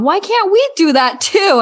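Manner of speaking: put-on high, pleading child's question; kids asking mom. Child asking her mom why not. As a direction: ask it like a puzzled little kid. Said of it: "And they ask their mom".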